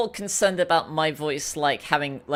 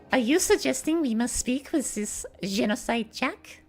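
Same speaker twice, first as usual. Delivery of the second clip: put-on higher character voice with French accent; Celeste; 3 semitones higher than usual. Voice actor questioning the plan, higher French-accented character voice. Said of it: "I can't do the voice".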